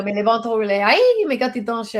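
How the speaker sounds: quick Cajun French patter. Retold or imitated. Guest imitating her Cajun people, quick Cajun French. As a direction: rattle it off like a Cajun. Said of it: "You know, the Cajuns".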